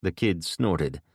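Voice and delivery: usual speaking voice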